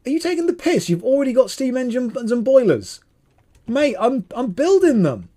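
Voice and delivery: high, loud, incredulous protest; exasperated outburst. Real, not acted. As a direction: squawk in disbelief at a teammate's blunder. Squabbling with teammate over boilers.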